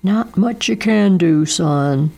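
a touch of gloomy resignation; resigned, heavy-hearted dad; sad father's voice. Acted. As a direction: Daddo sadly tells his son. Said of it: "shaking his head sadly".

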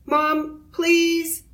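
put-on high pleading child's plea; begging. A narrator's reading. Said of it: "begged Arthur".

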